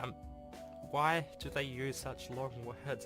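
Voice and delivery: normal talking voice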